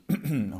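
sharp throat clear; loud throat clearing. Real, not acted. Teacher clears his throat before the class, sharp throat clear. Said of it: "Ich räuspere mich".

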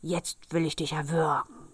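menacing corpse voice in an altered timbre; dead man's threat. A narrator's reading. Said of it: "Der Tote aber hub an und rief". (drohende Stimme eines Toten)

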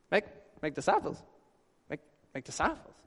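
flat, deflated repeating under the breath; unimpressed mutter. Retold or imitated. Disciples in the back, unimpressed by the commission, muttering. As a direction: mumble it like a let-down disciple. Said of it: "I can imagine them in the back going".

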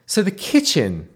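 ordinary talk, nothing marked

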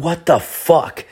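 high, slow, stretched what the fuck; stunned, dragging out each word; shocked disbelief. Retold or imitated. Shock at sudden illness.

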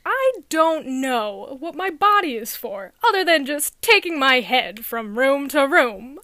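slow, loud stand-up comedian intonation; John Mulaney. Retold or imitated. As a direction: deliver the bit loudly, drawing out each word. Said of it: "imitate his intonation as best as possible".

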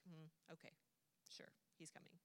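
very soft, noncommittal acted reply; muted indifference. Mary stays in the house on hearing the news, muted indifference. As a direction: imitate someone barely responding, half under the breath.

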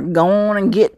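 playfully imitated southern twang; southern accent. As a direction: slip into the southern catchphrase. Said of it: "I'm saying it with my southern accent".

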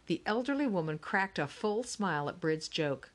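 ordinary delivery, nothing marked

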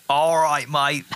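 playful Australian "mate"; Australian accent. Acted. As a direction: repeat the hammed-up Aussie farewell. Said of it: "Can you do that every time?".

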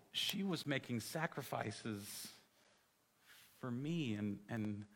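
soft, slow recollected revelation; hushed realisation. Retold or imitated. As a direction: let it dawn on you slowly and quietly. Retells tearful moment of sudden realisation.

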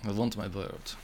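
soft, bad Russian-villain accent; Mickey Rourke. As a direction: quietly mimic a film villain's terrible Russian accent. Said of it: "That was the worst accent".

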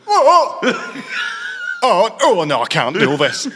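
hammed-up high, loud cry of disgust; mock horrified wail. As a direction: imitate someone recoiling, wailing and half laughing. Imitates manager recoiling at toilet door.

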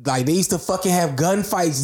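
ordinary talk

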